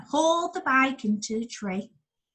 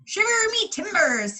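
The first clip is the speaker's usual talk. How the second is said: pirate exclamation; 10 semitones higher, 3 dB louder than usual. Acted. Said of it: "he exclaimed".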